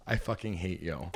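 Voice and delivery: quiet laughing curse; under-breath laughing insult; laughing mock venom. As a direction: hiss it through a laugh. Inner thought with laughter.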